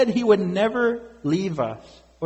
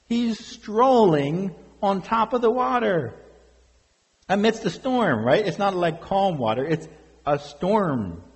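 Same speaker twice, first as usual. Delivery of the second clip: ordinary talk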